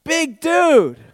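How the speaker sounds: big dude, shouted high and loud; big emphatic call. Real, not acted. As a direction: belt it out to the crowd. Preacher stresses giant size.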